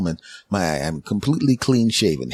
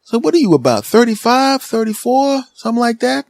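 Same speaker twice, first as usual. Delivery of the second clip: high, loud excited clerk; store clerk voice; 6 dB louder than usual. Retold or imitated. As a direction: play the hyped-up shop guy calling out.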